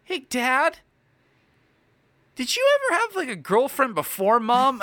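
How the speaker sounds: put-on high-pitched curious little girl; imitated young daughter. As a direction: imitate a child asking Dad out of the blue. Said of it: "and she goes".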